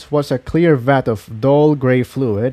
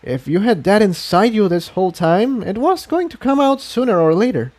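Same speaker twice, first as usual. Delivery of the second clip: lightly voiced gentle pony; 7 semitones higher than usual. A narrator's reading. Mare reassuring her friend, lightly voiced. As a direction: voice the mare kindly.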